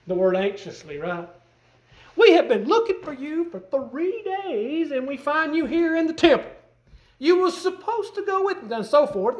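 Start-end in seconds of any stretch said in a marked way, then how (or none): 2.1-8.7 worried mother. Retold or imitated. Preacher voicing Mary finding her son in the temple, lightly worried. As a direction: fret over a missing son. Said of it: "a motherly talk to her son".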